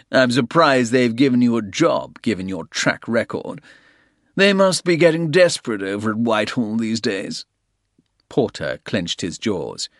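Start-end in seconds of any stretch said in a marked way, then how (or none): 0.1-7.5 louder, higher sneer. A narrator's reading. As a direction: look down on him with scorn. Soames sneers at Porter.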